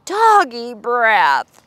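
slow, drawn-out panicked cry; scared scream. Acted. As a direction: wail it like terrified soldiers. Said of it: "screamed the soldiers".

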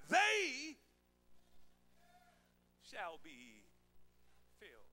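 "They" stretched high, then a long pause; drawn-out emphatic "They", hushed falling finish; stress on They. Shown as a demonstration. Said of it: "the emphasis is on they".

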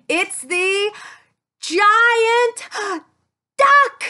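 gasp, then loud high duck; wide-eyed discovery; amazed reveal. Real, not acted. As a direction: build to a loud surprised cry.

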